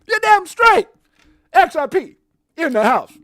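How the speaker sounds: loud, high hyped yell; hype shout. Real, not acted. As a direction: hype the crowd. Hyped shout about XRP.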